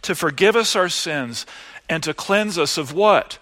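normal talking voice

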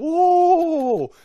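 long, loud, high-pitched whoa; hammed-up crowd amazement; amazed crowd whoa. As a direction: draw out an awestruck whoa. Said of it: "people are like".

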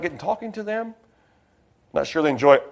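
ordinary delivery

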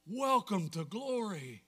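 slow, measured welcome at normal volume; awed repeat. Real, not acted. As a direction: let the words sink in slowly. Repeats the climax more softly.

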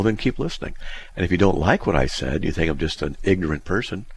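ordinary delivery

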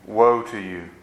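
ordinary talk, nothing marked

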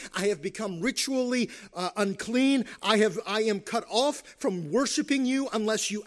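emphatic, driving plea to God; impassioned confession. Acted. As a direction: preach the confession out, hitting each admission. Preacher voices David's forceful confession.

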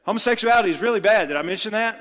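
a pastor stuck on one line, pushed up loud; exasperated mock preacher. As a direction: hammer the same point harder, sarcastically. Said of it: "And they say".

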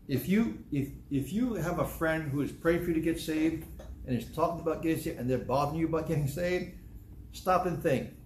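ordinary delivery

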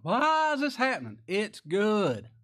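high, loud mock big-brother voice; stern older brother. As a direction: play the austere older brother lecturing. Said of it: "big, mean, austere older brother".

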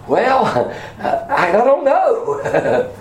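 high, halting, drawn-out mock uncertainty; hammed-up baffled messenger with a laugh; puzzled hesitation. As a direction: act stumped, breaking into a chuckle. Imagined disciples' puzzled reply to Greeks.

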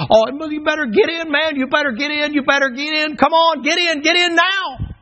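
high, loud pleading shout to come aboard; urgent call. Acted. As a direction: beg them to get in, hollering. Preacher voicing Noah pleading crowd.

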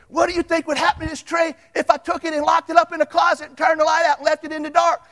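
high, loud, fast triumphant question; spring the question on the kid; teacher asking. Retold or imitated. Man challenging the homeschool kid with a loud question. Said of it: "he told Doctor Beasley's little kid".

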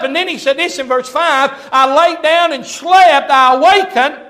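loud, rising pulpit delivery; impassioned preaching. Real, not acted. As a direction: proclaim the scripture with full force. Preaching at full force.

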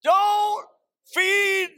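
loud, high, drawn-out slogan; slogan da ripetere. Shown as a demonstration. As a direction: belt out each word slowly for the crowd to repeat. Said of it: "Let's say it together".

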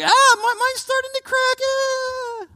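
very high, squeaky mock panic; comic high-pitched fretting voice; mock worried believer. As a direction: play a fretful churchgoer checking their seal.

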